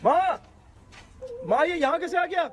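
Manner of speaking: high-pitched shocked call to mother; alarmed cry. Acted. Son startled by what is hanging at home, alarmed. Finds the scary doll at home.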